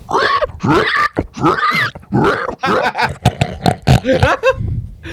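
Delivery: high squealing, snorting pig; pig noises. Pretend. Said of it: "can you make some pig noises for me".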